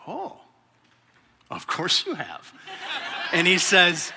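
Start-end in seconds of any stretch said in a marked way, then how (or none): 0.1-2.5 dry, drawn-out, quiet sarcasm; sarcastic. Retold or imitated. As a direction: say it as if you know you're in trouble. Sarcastic reply to greeter.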